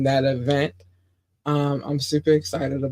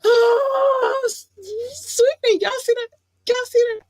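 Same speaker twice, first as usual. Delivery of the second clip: much higher, shrieking with delight; real excited squealing; over-the-top excitement. Real, not acted. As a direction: lose it over Sweden. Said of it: "I gotta calm down".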